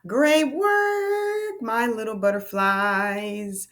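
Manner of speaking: put-on high, sing-song praise to little kids; cheering on her class, bright and high; excited praise. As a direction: gush over them, voice up high. Teacher praises children.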